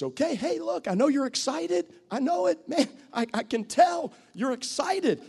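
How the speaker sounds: put-on steer an overeager kid gently; calming an excited one. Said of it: "that says".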